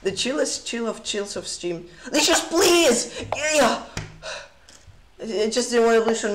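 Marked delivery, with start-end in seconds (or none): 2.1-3.8 high, loud, drawn-out plea; voice crack. Real, not acted. Streamer begging lagging chess site, cracking plea. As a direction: plead loudly till the voice cracks. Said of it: "Just little voice crack".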